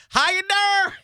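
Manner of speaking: high, loud, drawn-out pickup line; Joey catchphrase. Retold or imitated. Friend doing the catchphrase for Holly, high and loud. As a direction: ham up the cheesy flirt line. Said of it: "I'd be like".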